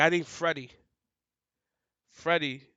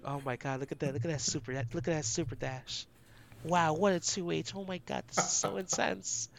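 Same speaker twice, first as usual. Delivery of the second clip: soft, smooth, seductive commentary; silky, hushed hype-man murmur; smooth sexy voice; 7 dB quieter than usual.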